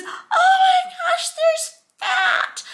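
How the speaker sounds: put-on high, loud crying wail of a small boy; crying child scream. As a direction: wail like a panicked little kid. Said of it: "crying and screaming".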